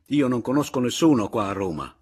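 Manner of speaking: loud, high complaint; exasperated outburst. A narrator's reading. Narrator friendless in Rome, loudly exasperated. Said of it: "proruppi ancora una volta esasperato".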